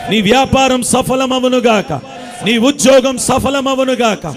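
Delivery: slow, ringing pronouncement of blessing; proclaiming blessing. Acted. Preacher declaring blessings over the congregation, ringing and slow. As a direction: call each blessing out over the crowd.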